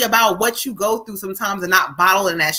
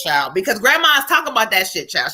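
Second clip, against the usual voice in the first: normal talking voice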